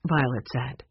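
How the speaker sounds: ordinary delivery, nothing marked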